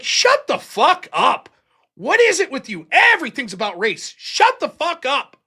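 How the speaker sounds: high, slow, loud shut-down; exasperated shout. Retold or imitated. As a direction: tell him off once and for all. Bob shuts John down.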